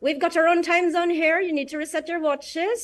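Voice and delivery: Newfoundland accent, light and folksy. Shown as a demonstration. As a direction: explain the time zone like a proud Gander local. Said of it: "Ganun yung accent ng, um, Gander, Newfoundland".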